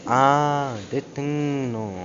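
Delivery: drawn-out vowels in "а, дитину"; emphatic lengthening. Shown as a demonstration. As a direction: stretch "дитину" very slowly for emotional emphasis. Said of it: "являється подовженням вимові голосних". (розтягнуті голосні: "а, дитину")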